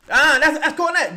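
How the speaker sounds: sudden loud excited call; excited shout. Real, not acted. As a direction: yell it out at the big play. Excited reaction to a play.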